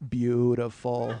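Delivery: low, slow, drawn-out purr after a chuckle; Jack Nicholson. Retold or imitated. Star to the extras in his face, low slow approval. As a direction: savor the word slowly and quietly. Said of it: "and he just goes".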